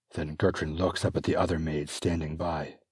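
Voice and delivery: ordinary delivery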